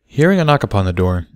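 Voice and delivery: ordinary delivery, nothing marked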